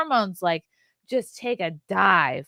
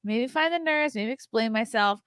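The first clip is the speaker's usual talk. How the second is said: hurried inner voice; 6 semitones higher than usual. Retold or imitated. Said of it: "but really in her brain".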